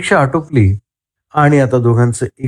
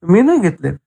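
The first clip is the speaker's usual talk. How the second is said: taken aback, higher-pitched denial; startled; 9 semitones higher than usual. A narrator's reading. Raj suddenly accused of taking the money, startled. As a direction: protest as if caught off guard. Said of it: "राज दचकत म्हणाला".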